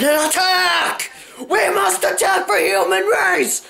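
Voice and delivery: high, rallying battle shout; battle cry. Pretend. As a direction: charge into battle.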